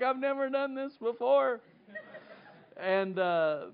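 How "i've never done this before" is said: high-pitched panicked whimper; mock panic. Preacher reenacts his reaction to the lost thumb, high mock panic. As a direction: squeak it out in comic distress. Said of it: "you know, I'm like".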